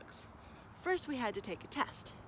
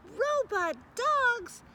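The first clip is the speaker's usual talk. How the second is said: much higher, louder, slow delighted cry; excited children; 13 semitones higher, 8 dB louder, much slower than usual. Acted. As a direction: gasp with wonder.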